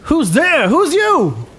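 high, hollered challenge; high-pitched young thug; gang member challenging intruders. Acted. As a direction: call back at strangers in a put-on tough voice. Game master plays approaching gang member.

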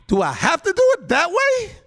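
very high, loud imploring question; protesting plea. Retold or imitated. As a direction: plead it as a high, incredulous question. Voices Jesus questioning the Father.

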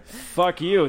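laughing out the curse. Real, not acted. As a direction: laugh through it, loud. Laughing at the joke.

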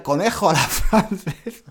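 a giggle breaking into the line; trying to read it but laughing; reading the joke lyric through laughter. Real, not acted. Rereading lyric, laughing again.

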